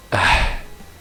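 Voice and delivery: loud breathy sigh; exasperated sigh. Real, not acted. Player giving in to the game, exasperated sigh. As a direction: heave a big voiceless sigh.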